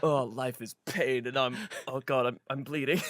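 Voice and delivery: low, subdued mock-teen misery; moody teenager. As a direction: drag it out like a self-pitying adolescent. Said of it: "like, his, his bad phase".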